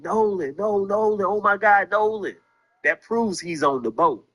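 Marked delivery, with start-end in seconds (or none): 0.0-2.4 a hint of panic, pitched a little higher; imitated panic. Commentator replaying the friends' words to the audience, mild mock panic. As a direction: lightly act out the friends' alarm. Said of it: "we hear y'all saying".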